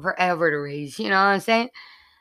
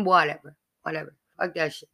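normal talking voice

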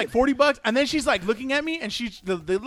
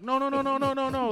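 higher, rushed string of no's, mock panic; panicked refusal; 7 semitones higher, 4 dB quieter, faster than usual. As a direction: plead no over and over as if caught off guard. Refusing the masseuse in retelling.

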